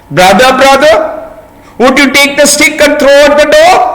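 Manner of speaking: high, small pleading ant voice. Acted. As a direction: beg sweetly like a tiny ant.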